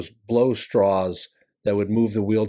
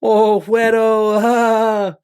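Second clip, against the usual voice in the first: playful crowd ribbing, pitched up; 8 semitones higher, 5 dB louder, slower than usual. Retold or imitated. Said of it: "they're like".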